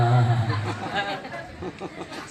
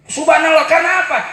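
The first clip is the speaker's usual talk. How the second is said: high, loud preaching cry; shouted exclamation; 9 semitones higher, 5 dB louder, faster than usual. Acted. Preacher praising God to congregation, shouting. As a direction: call it out to the hall.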